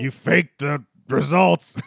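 slow, drawn-out dramatic accusation; hammed-up movie quote. Quoting The Fugitive to a co-host, hammed-up accusation. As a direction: ham up the big movie line, word by word. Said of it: "not gonna win any awards".

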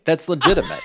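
amused laugh through the words. Real, not acted. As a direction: laugh while saying it. Laughing at absurd phone message.

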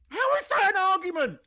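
high, loud, indignant challenge; exasperated outburst. Real, not acted. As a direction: shout it in sheer disbelief. Heated rebuttal of imagined argument.

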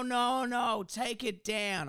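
put-on high, slow insistent old woman; old lady voice. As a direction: mimic the stubborn neighbour. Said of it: "She's like".

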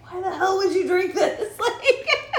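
high, laughing aside to camera. Retold or imitated. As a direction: break into disbelieving laughter. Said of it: "look at the camera".

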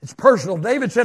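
usual speaking voice

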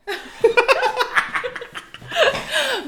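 high, bright burst of laughter; big laugh. Real, not acted. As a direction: let a loud laugh burst out.

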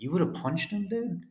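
incredulous reply. Retold or imitated. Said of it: "It's like".

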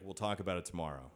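low, soft, quick dismissal; low, hushed and hurried reply; quiet brush-off. Retold or imitated. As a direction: imitate her putting it off at bedtime. Fiancée puts off question in bed.

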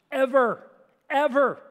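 both "ever" punched loud and high; stressed ever. Real, not acted. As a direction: hit each "ever" hard, rising. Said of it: "preacher stresses ever".

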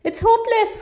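high, despairing wail; despairing cry. Acted. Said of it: "cried Moose".

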